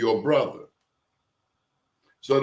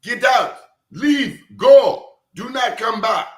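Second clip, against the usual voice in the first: high, loud "Get out. Leave. Go." barked as orders; commanding; 9 semitones higher, 6 dB louder, slower than usual. Retold or imitated. Retelling Idi Amin ordering colonizers to leave, loud. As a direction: order them out like a ruler. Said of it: "he told them to get out".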